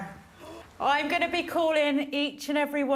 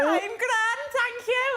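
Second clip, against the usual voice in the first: a hint of a bad Irish accent, higher; lightly mock Irish, pitched up; poor Irish accent; 11 semitones higher, slower than usual. Host greeting Kian in a poor Irish accent. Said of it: "that was a really poor Irish accent".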